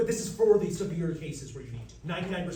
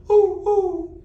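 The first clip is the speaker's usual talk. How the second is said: high loud hooting ooh ooh; owl hoot; 12 semitones higher, 12 dB louder than usual. Shown as a demonstration. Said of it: "Can I get an owl?".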